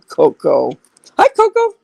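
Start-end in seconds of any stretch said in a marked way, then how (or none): 1.2-1.7 high cooing greeting. Real, not acted. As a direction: coo at the dog up high. Greeting dog in baby talk.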